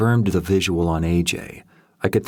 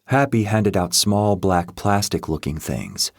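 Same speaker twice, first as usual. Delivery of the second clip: usual speaking voice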